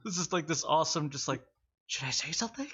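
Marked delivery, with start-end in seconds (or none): 1.9-2.7 higher-pitched mock questioning voice; imitating a teammate. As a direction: mimic the hesitant question, rising at the end. Mimics player's line in character.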